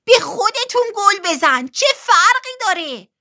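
very high, loud yell; shouting. A narrator's reading. Billy yells advice to Betty during the match, high loud shout. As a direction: holler it from the sidelines. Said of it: "بیلی داد زد".

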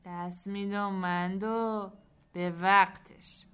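slow, weighted answer; measured character reply. A narrator's reading. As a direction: draw it out, landing hard on the last word. Soltan answers, voiced slowly. (جواب کشدار و سنگین)

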